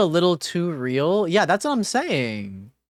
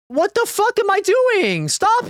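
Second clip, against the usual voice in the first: squealing at his own mistake; high panicked shriek; 13 semitones higher, 3 dB louder than usual. Real, not acted. Panicking at game choice.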